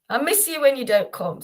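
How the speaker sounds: ordinary talk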